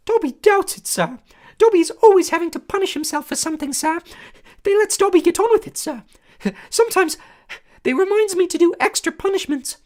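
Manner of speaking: high, loud squeaky elf voice; a small eager servant creature, awed; squeaky house elf. A narrator's reading. House elf greeting Harry, high squeaky and awed. Dobby voice.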